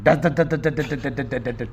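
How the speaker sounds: rapid mouth gun rattle, slow; gunfire imitation. Gunfire after shooting poem. (بندوق کی گولیوں کی نقل)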